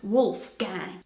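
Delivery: "Wolfgang" as wolf-gang in American English; slow American reading of "Wolfgang", English "gang"; American accent. Shown as a demonstration. Showing how Americans say the German name, slow American accent. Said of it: "Pronounced with an American accent".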